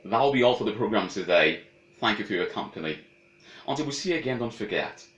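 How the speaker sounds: radio sign-off. Acted. Host signing off to listeners in announcer voice. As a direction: close the show like a broadcaster.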